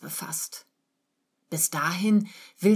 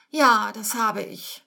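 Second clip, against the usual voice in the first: slow, higher-pitched, woeful admission; meek, close to tears; 4 semitones higher, 5 dB louder, slower than usual. A narrator's reading. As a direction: admit it haltingly, as if about to cry. (kleinlaut, den Tränen nahe)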